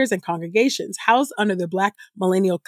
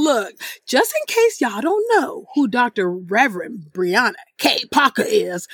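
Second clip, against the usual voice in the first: ordinary delivery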